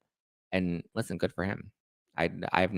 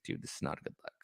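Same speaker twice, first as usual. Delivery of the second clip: whispered aside; 15 dB quieter, much faster than usual. Retold or imitated. Schwartz warning Sandoval, quoted aside.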